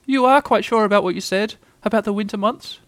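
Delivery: very high little animal voice; nervous Piglet. A narrator's reading.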